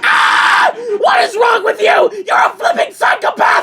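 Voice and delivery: shrieking very high and loud; terrified scream. Acted. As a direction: scream in panic at the attacker. Terrified at pencil attacker.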